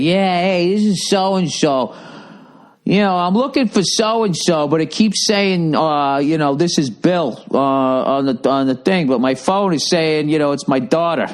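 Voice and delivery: lightly voiced confused stranger leaving a message; caller voice. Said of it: "leave a message and it's just like".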